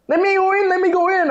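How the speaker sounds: very high-pitched mock pleading demand; pushy woman. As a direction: imitate an insistent woman shoving through. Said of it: "aged forty women just pushed".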